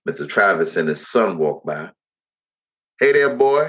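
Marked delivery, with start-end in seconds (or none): 3.0-3.7 called out loud and high; a loud, cocky hail across the yard; mock shout from passing neighbor; shouted greeting. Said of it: "he shouted".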